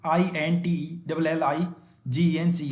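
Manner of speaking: slow dictation of the letters; slow spelling dictation. Shown as a demonstration. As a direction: read each letter out slowly for the class. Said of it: "तो intelligence क्या हमारी?".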